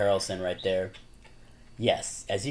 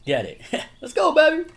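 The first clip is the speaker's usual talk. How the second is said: louder, then much higher whoop with a laugh; mock hype cheer; 11 dB louder than usual. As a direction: cheer him on in a put-on squeal. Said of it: "He's like".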